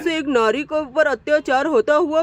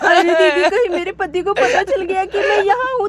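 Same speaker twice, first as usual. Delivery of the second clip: tearful, higher-pitched sobbing plea; crying; 3 semitones higher than usual. Acted. Wife in hiding fears her husband will find her, crying. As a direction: sob through the words in fear. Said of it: "ये रोने की आवाज".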